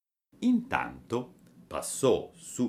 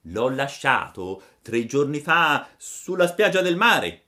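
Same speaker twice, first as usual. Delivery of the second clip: pigeon storyteller, emphatic; 8 semitones higher, 9 dB louder than usual. A narrator's reading. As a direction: declaim the news as the big pigeon. Pigeon character speaks.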